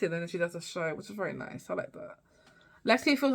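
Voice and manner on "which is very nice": ordinary talk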